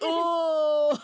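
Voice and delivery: high, loud fake shock; mock surprised guy. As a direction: play the stunned friend. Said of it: "He's like".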